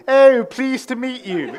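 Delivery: high, loud mock-posh English greeting; posh English accent. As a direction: imitate Amy going all posh English. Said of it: "They don't speak like that normally".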